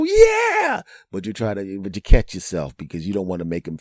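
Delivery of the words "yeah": very high, loud burst of joy; gleeful cheer. Acted. Host hearing the guest will go home, loud cheer. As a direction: let out a hammed-up whoop.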